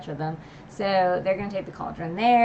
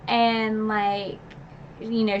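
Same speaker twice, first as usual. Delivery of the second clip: ordinary delivery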